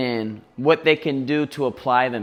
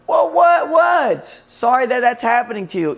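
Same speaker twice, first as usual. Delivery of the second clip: high, loud, flustered stammer; flustered self; 10 semitones higher, 6 dB louder than usual. Retold or imitated. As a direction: sputter like you're caught off guard. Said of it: "And I'm like".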